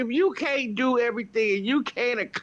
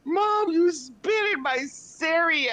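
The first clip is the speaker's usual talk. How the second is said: high, slow imitation of her son; her son's complaint; 8 semitones higher, slower than usual. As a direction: mimic the boy, pitched up and drawn out. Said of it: "And he was like".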